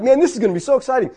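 high, quick burst of excitement; ecstatic. Retold or imitated. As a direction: gush it fast and high, thrilled. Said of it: "I'm ecstatic 'cause I'm like".

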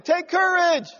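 high, slow mock-cheery call; hammed-up rallying cry, drawn out; calling out encouragement. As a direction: call to someone with exaggerated lift. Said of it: "they called the blind man".

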